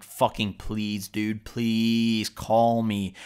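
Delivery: drawn-out, slow plea; pleading. Retold or imitated. Man tapping his foot silently begs friend to call, pleading. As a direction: stretch each please out, begging.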